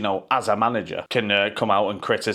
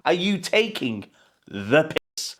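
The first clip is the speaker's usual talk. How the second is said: normal talking voice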